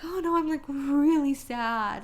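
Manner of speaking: high, loud, drawn-out wail; hammed-up weepy lament; exaggerated sadness. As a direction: whine it with mock heartbreak. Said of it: "the volume's turned up".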